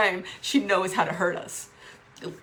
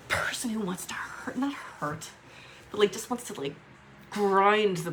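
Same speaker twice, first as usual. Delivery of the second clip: whispered. Real, not acted. Describing the angry part of her, whispered. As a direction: murmur it like a secret.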